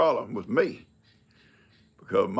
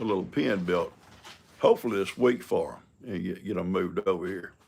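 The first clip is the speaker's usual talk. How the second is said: ordinary delivery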